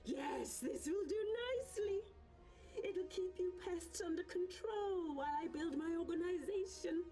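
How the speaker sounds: self-satisfied evil mastermind; villain's gloat. Acted. Villain gloats over controlling pests.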